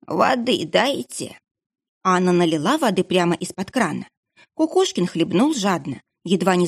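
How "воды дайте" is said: slow, rasping plea for water; coughing up water. Acted. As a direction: croak it out between coughs. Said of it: "хрипя и кашляя".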